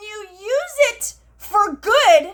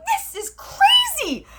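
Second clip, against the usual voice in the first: incredulous; 13 semitones higher, slower than usual. Retold or imitated. Retelling her own reaction on video.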